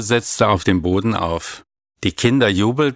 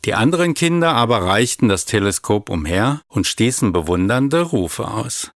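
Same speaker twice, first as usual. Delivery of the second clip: usual speaking voice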